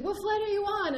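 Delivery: high, loud repeated question; retold insistent question. Airline lady repeating to the winded traveler, loud and high. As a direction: press for an answer, loud and high. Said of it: "she's like".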